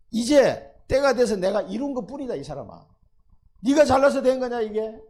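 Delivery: indignant loud reproach; loud scolding. Acted. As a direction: shout down a proud king. God scolds the proud king.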